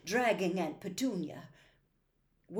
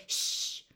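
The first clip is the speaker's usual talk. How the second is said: long voiceless shush; sharp "shh" for silence. A narrator's reading. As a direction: hush the others. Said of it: "Somebody inside the chimney piece said".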